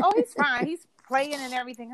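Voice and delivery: imitating an unfazed daycare lady; reassuring caregiver. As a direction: brush off the worry lightly. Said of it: "the lady that was caring for him".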